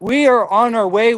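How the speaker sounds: ordinary delivery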